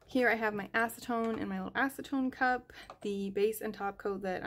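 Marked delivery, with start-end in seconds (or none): none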